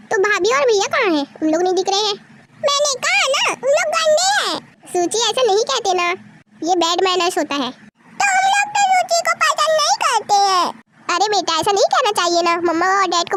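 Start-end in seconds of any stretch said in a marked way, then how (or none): 2.6-4.6 put-on sulking child. Child insists. 7.7-10.7 put-on slow sulking child. Child complains slowly.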